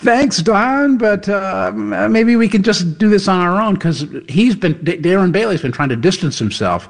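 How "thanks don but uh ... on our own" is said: high-pitched, halting brush-off; awkward polite refusal. Retold or imitated. Candidate turns down an endorsement, imitated awkward politeness. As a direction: imitate a candidate nervously declining help. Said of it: "Bailey's been kind of like".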